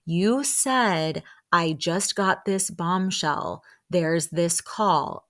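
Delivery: slow, over-deliberate imitated explanation; slowly, like to a child. Host confronting her about the call, slow as to a child. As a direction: space it out as if for a child. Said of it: "[name] says slowly, like to a child".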